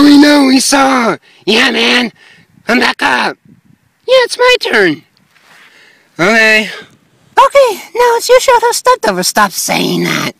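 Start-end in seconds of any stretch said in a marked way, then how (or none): 4.1-4.5 high character voice. Acted. 7.4-9.0 high character voice. Acted.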